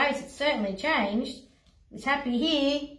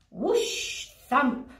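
whoosh sound effect; 4 semitones higher than usual. Acted.

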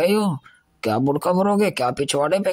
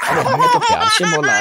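mock innocent; 4 dB louder than usual. Teaser feigns innocence.

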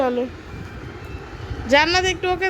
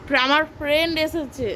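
normal talking voice

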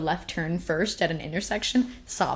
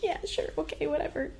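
higher and softer, a sarcastic brush-off; dismissive teen; 9 semitones higher, 5 dB quieter than usual. Retold or imitated. Brushing off her mom's comfort, sarcastic. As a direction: wave it away like a sulky kid. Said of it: "And I'm like".

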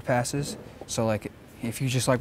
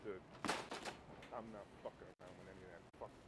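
muttering; 6 semitones lower, 28 dB quieter, faster than usual. Real, not acted. Muttered far off.

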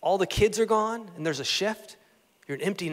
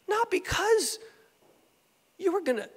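high-pitched, halting disbelief; imitated dismay; stunned disciples; 10 semitones higher, 3 dB quieter than usual. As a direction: voice a bewildered objection, way up high. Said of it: "They, they were stunned".

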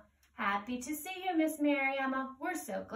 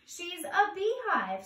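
Ant voice; 7 semitones higher than usual. Acted.

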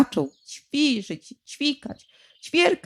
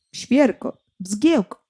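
plain reading voice

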